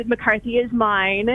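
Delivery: ordinary delivery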